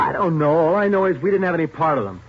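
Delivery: loud, pleading denial; defensive protest. Acted. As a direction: push back hard against the cops. Suspect denying under interrogation.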